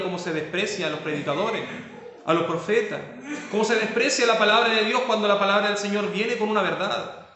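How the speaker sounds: normal talking voice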